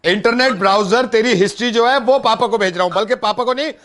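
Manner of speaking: high, emphatic announcement; mock threat. Prankster threatening to send browser history to family, mock-outraged. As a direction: raise the pitch and drive the threat home.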